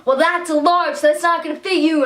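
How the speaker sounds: high, loud mock-snooty vendor; rude tone. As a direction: imitate a dismissive seller talking down. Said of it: "in, like, a rude tone".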